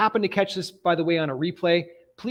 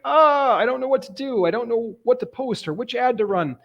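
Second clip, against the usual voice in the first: confused newcomer venting to the coach, higher and exasperated; overwhelmed client; 7 semitones higher than usual. Retold or imitated. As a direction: play a flustered beginner who is lost. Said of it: "People are like".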